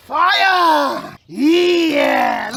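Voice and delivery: high, loud cheer; cheering the fire alight, shouting; excited shout. Real, not acted. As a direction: whoop as the flames catch. Fire lights.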